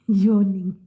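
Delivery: bursts into loud laughter; laughing. Real, not acted. Vlogger cracking up over her own yawning, laughing loudly. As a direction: laugh out the word, delighted.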